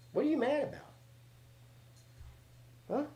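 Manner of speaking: high pet-talk voice; high-pitched voice for the cat. Real, not acted. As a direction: ask the cat in a high coo. Talking to the cat.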